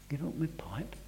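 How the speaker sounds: incredulous whisper; whispered question. Retold or imitated. Old man reasoning with himself, whispered disbelief.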